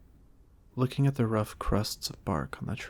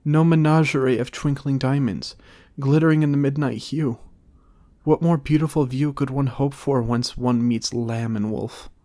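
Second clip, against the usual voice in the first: louder, higher theatrical verse; grand declamation; 5 semitones higher, 9 dB louder than usual. A narrator's reading. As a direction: perform it for the crowd. Maga's grand speech.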